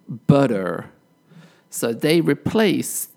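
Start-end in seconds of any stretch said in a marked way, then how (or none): 0.1-0.9 "butter" with the T softened to a D; American pronunciation. Shown as a demonstration. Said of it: "Americans would say".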